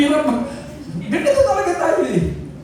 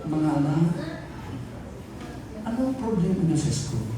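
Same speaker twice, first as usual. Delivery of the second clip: soft, lower, tender asking; gentle parent voice; 8 semitones lower, 8 dB quieter than usual. Shown as a demonstration. Pastor showing how he talks to his children, soft and tender. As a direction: coax the kids softly like a caring mother. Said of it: "Ganito ako magsalita".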